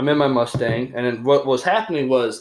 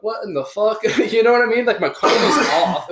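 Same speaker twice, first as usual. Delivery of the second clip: high pitched, laughing it out; giggly amazement; laughing disbelief; 9 semitones higher, 4 dB louder than usual. Real, not acted. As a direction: crack up at the absurdity. Retold disbelief then laughing.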